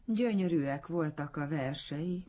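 high-pitched, effusive praise; gush, barely recovered from tears. A narrator's reading. Admirer praising the poet's verses afterwards, gushing. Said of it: "áradozta könnyezésből alig lábadozva".

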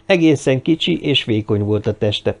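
ordinary delivery, nothing marked

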